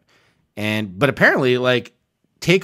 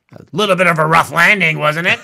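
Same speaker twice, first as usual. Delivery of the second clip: mock passenger; 4 semitones higher, 7 dB louder than usual. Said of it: "who was like".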